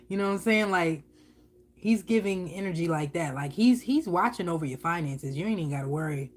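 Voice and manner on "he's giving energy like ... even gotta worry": ordinary talk, nothing marked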